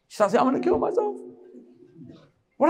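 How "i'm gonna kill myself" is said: high-pitched imitation of a crying girlfriend; mock crying threat. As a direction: mimic her tearful threat, pitched up. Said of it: "then she starts crying".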